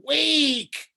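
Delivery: high, loud call to wake; high loud ghostly call; ghostly cry. A narrator's reading. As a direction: cry out to rouse him. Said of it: "dead whispered".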